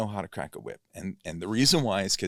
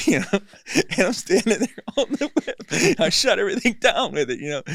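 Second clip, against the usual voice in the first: real laughter, high and loud; laughing proudly at shutting the room down; 12 semitones higher, 10 dB louder than usual. Real, not acted. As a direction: laugh through the words with delight. Laughing recalling the whip crack.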